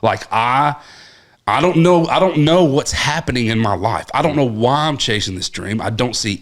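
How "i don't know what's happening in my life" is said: normal talking voice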